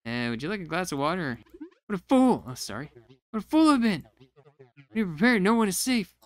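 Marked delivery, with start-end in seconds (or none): 1.8-2.4 dramatic outcry. Acted. Captain's dramatic outburst. 3.3-6.2 dramatic captain. Acted.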